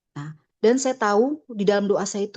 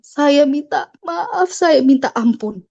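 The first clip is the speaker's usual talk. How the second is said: slow, drawn-out begging, higher and louder; retold weepy apology, stretched out; imitated tearful plea; 5 semitones higher, 5 dB louder, slower than usual. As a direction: mimic a crying husband pleading for forgiveness. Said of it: "dia nangis".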